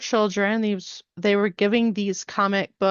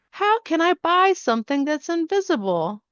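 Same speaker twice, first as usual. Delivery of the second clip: lightly voiced puzzled girl, pitched up; 7 semitones higher than usual. Sophia asks the scientist how to buy it, lightly voiced puzzled girl. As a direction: lift the pitch for a child's baffled question.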